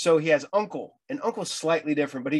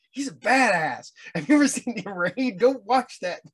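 excited fan; 7 semitones higher, 4 dB louder than usual. Retold or imitated. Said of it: "I'm like".

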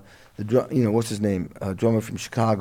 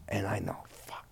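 whispered curse of dread; 4 semitones lower, 16 dB quieter than usual. Retold or imitated. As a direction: breathe it out under the breath. Dreading the firing call.

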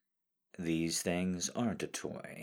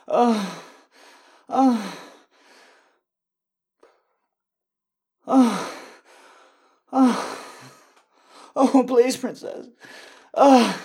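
high, loud, frantic begging; loud desperate pleading; 13 semitones higher, 12 dB louder than usual. Acted. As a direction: build to frantic cries. Climbing high loud pleas.

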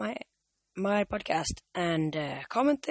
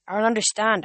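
louder, pressing question; Percy protests to Thalia, louder; confused outburst; 4 semitones higher, 7 dB louder than usual. A narrator's reading. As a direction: blurt out that you don't get it.

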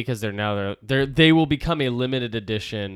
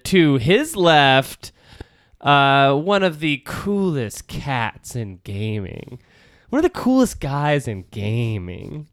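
slow, drawn-out host intro; announcer voice; slower than usual. Acted. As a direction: introduce the guest like a showman. Announcing a guest grandly.

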